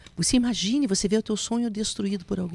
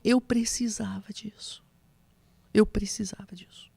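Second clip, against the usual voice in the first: tearful whisper; 5 dB quieter than usual. Retold or imitated. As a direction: barely breathe it out while hugging tight. Me abraça forte e falou assim.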